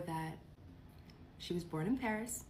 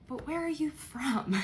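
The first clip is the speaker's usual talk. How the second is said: higher, louder demand for an answer; straight-up ask, pitched up and louder; blunt questioning; 7 semitones higher, 5 dB louder than usual. Retold or imitated. Bluntly confronting mom about origins.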